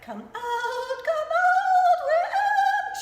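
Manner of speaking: very high, slow, sung fairy voice; Glenda the Good Witch. Retold or imitated. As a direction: float it up like a hummingbird. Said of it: "she sounds like ... a fucking hummingbird".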